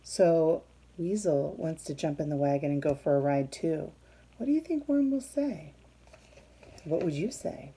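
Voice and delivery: ordinary delivery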